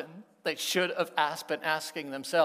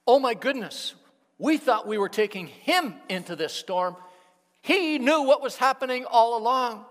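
loud, high dawning shock; loud breathless epiphany; stunned realization; 7 semitones higher, 10 dB louder than usual. Acted. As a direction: blurt out the sudden realization. Disciples' realization, voiced loud.

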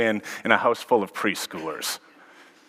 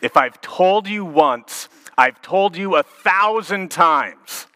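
slow, higher exasperated parent line; 6 semitones higher, 3 dB louder, slower than usual. Retold or imitated. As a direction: drag it out like a fed-up mom. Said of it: "have mom or dad use that line".

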